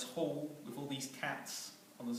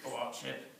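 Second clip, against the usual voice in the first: cat's voice, laughing. Acted. Cat answers the villain.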